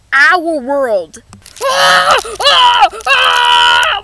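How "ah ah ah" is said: loud high screams; terrified screams. Acted. Fleeing robots taking over, loud panicked screams. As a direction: scream as you run for it.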